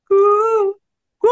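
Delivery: high gliding cuckoo hoots; whooped bird calls. Shown as a demonstration. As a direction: imitate a cuckoo with a wave in the coo. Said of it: "এই যে, এই যে ঢেউ".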